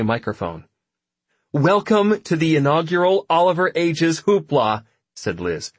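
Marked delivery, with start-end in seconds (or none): 1.5-4.8 higher, louder show announcer. Acted. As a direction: announce it to the party crowd. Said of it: "I'm your announcer".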